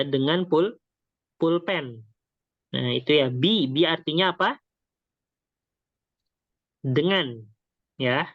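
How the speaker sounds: usual speaking voice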